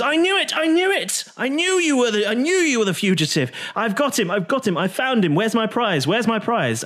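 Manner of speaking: a gleeful bounty hunter crowing over her catch; high-pitched victorious shrieking; excited drow woman. Acted. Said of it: "the drow woman ... says".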